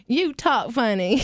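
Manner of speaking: slow cowboy impression in a Southern accent. Cowboy on a plane to English musicians, imitated Southern accent. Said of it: "there was this real cowboy".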